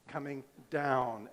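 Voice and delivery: "down" slowed and leaned on; stress on down. Shown as a demonstration. Preacher to congregation, stressing "down". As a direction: land hard on "down", drawn out. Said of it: "I tried to emphasize that word down".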